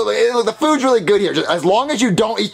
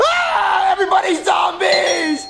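high, drawn-out terrified wail; panicked shriek; much slower than usual. Acted. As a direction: shriek in cartoon terror. Discovers everyone is a zombie.

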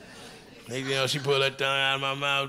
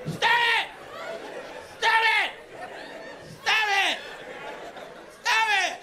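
very high, repeated shrieked "stop it!"; snapping shout. Retold or imitated. Comic snapping at the woman in bed, shrieking. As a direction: shriek it over and over, losing it. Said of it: "And I snapped".

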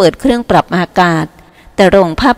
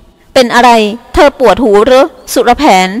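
put-on higher, louder playful teasing girl; teasing dancer; 4 semitones higher, 5 dB louder, slower than usual. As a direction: poke fun at him with a sing-song lilt. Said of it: "หางเครื่องในชุดอาบน้ำสีฟ้าล้อ". (น้ำเสียงหยอกล้อของสาวหางเครื่อง)

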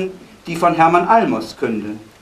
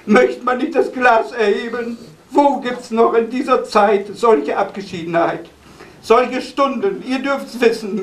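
raised pitch, exclaiming recitation; heightened poetic toast; 6 semitones higher than usual. Real, not acted. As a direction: lift the voice high for the poem's rousing close. Poem builds to a toast.

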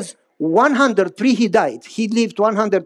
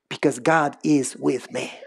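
lower, softer grandfather voice; old grandfather; 7 semitones lower, 5 dB quieter than usual. Retold or imitated. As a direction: speak as the wise old grandpa.

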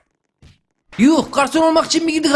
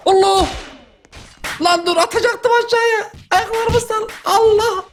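panicked yelp; 9 semitones higher than usual. Real, not acted. Panics while being carried off.

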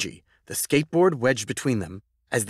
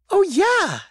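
put-on high, loud, eager child cry; excited exclamation; 5 dB louder than usual. Gigi recognising Roosevelt's parks from school, excited exclamation. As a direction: burst out with recognition. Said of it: "exclaimed Gigi".

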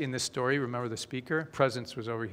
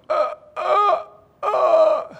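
choking gasps; 10 dB louder, much slower than usual. Acted. Choking woman gasping.

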